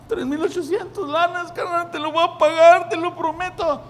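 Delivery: high, nervous promise to pay; hammed-up worried debtor; pleading debtor. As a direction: beg for time, voice up high.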